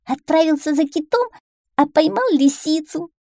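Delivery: high little duckling voice; young duckling, boasting. A narrator's reading. Duckling telling mother of the caught fox, high boastful voice. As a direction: pipe up with a boast.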